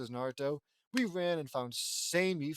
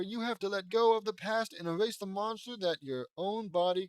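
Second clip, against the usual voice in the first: high, loud, dramatic exhortation; rally a friend at full force; theatrical heroic plea, raised voice; impassioned urgent speech; 8 semitones higher, 8 dB louder than usual. Acted. Hero's urgent climactic speech.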